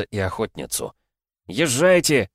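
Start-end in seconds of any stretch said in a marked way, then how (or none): 1.5-2.3 loud urgent shout. Acted. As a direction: yell them away. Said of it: "закричал Игрорыцарь". (громкий крик)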